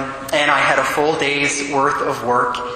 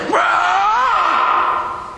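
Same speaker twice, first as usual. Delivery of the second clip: a sudden very high, raw scream bursting out; demonic roar. Retold or imitated. Man pinned to the floor by angels, screaming out. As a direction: let the demon's cry tear out of him.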